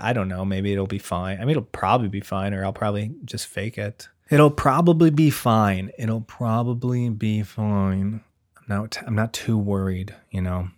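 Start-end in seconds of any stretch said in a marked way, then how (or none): 4.3-5.9 higher, louder and drawn out; emphatic reassurance. Pretend. Worried dad-to-be convincing himself, loud emphatic reassurance. 6.0-8.2 very slow, stretched-out words at usual pitch; slow mock reassurance. Worried dad-to-be repeating it to himself, slow drawn-out reassurance.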